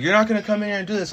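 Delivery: firm retold telling-off; stern. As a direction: retell laying down the law, without shouting. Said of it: "I just had a stern voice".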